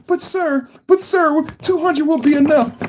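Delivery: squeaky, fretful subordinate pleading with the boss; nervous underling voice. Retold or imitated. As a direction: play the anxious aide begging his boss. Acting out the movie scene.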